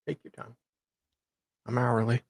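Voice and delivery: usual speaking voice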